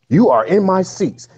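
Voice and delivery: high, slow, haughty lady voice; entitled woman accusing them of taking her seats. Retold or imitated. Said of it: "starts talking shit".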